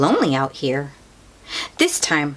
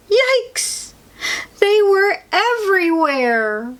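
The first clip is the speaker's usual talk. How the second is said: 1 alarmed; 13 semitones higher than usual. A narrator's reading. Noticing cracks in shell.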